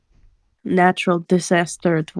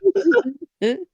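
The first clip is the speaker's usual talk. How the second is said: high teary laugh; 12 semitones higher, 3 dB quieter than usual. Real, not acted. Cracking up.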